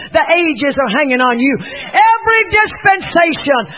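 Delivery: high, shouted sermon climax; loud, urgent pulpit shouting; preach. Real, not acted. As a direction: preach at full force, rousing the crowd.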